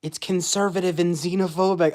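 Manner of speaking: high, loud mocking voice of a critic; parroting a silly online comment, high and loud; mocking quote. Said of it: "some stupid comment being like".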